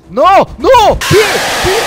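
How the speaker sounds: high, loud frightened shout; panicked shout. Real, not acted. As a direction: yell out in sudden fright. Caught in horror game.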